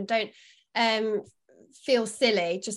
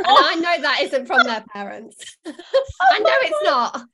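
loud, laughing through the words; 6 semitones higher, 11 dB louder than usual. Real, not acted. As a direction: break into a big laugh as you talk. Amused, laughing while talking.

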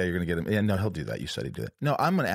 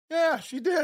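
very high, excited mock boast; excited bragging; slower than usual. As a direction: crow it way up high. Acted boastful answer about dating star.

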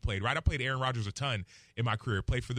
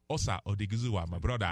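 put-on lower-pitched Nigerian accent; 7 semitones lower than usual. Football analyst switching into his Nigerian accent for the hosts. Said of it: "the accent".

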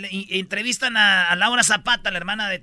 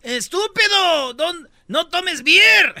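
higher, louder, slow scolding; mock yelling mother; 9 semitones higher, 8 dB louder, much slower than usual. Mother yells at the man drinking beer. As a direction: drag out the insult like a scolding mom. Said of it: "le grita".

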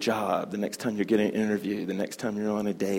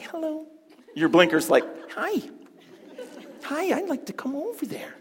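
sweet, much higher, timid little voice; polite blinker voice. Pretend. A turn signal asking permission to change lanes, timid and sweet. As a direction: play the blinker asking nicely.

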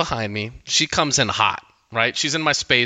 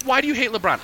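incredulous question; 11 semitones higher, faster than usual. Retold or imitated. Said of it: "And I'm like".

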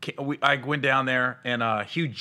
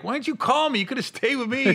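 high-pitched imitated complaint; exasperated friend in a high voice; mock protest; 11 semitones higher than usual.

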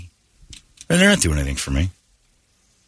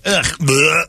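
disgusted bleh; 8 semitones higher than usual. Retold or imitated. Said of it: "And like".

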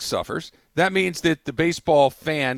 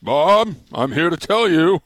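Judge Smales mash-up; 2 semitones higher, 6 dB louder, slower than usual. Retold or imitated. Host doing his Bob Kraft impression for the co-host, louder hammed-up old judge. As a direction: boom it like a pompous country-club judge. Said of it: "That's how they talk".

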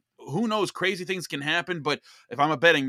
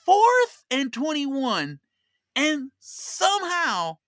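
higher, louder and very slow, stretching every word; dramatic disbelief; 10 semitones higher, 6 dB louder, much slower than usual. Acted. Podcaster recounting the wild game, slow and incredulous. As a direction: spell out the absurd down and distance.

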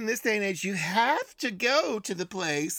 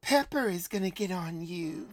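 phone sex lady voice; 5 semitones lower than usual. Acted. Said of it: "Pepper's my phone sex, uh, lady voice".

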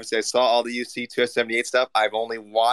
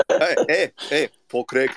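put-on Scottish accent, higher pitched; 4 semitones higher than usual. Shown as a demonstration. Co-host shows Mike his Scottish accent, putting it on. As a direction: try out a playful Scottish accent. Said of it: "has my Scottish accent".

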